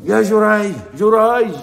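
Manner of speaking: loud, high-pitched call to her son; mother calling out. Retold or imitated. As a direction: shout his name as an impatient mother.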